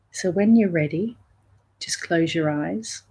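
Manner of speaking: soft, unhurried meditation voice with long pauses; guided meditation. Real, not acted. Teacher settling listeners into a breath meditation, calm and soft. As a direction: gently guide listeners inward, leaving space between phrases.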